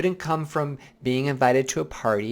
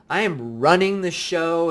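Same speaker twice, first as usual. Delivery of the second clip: autopilot bravado; 4 semitones higher, 5 dB louder than usual. Retold or imitated. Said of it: "You're like".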